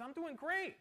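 lightly voiced cheerful woman. Church lady telling pastor at lunch she's great, lightly cheery. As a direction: toss off a light mock-cheery answer. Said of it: "She says".

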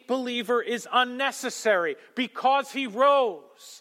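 high, loud preaching to the crowd; fiery preaching. Acted. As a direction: preach it with rising fire. Preaching at full force.

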